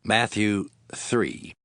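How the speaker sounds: ordinary talk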